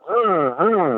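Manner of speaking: high buzzing drill noise, rrr rrr; imitated drill whir. Retelling the hospital story, imitates a cordless drill's high whir. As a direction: mimic a cordless drill spinning up. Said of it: "an electric drill".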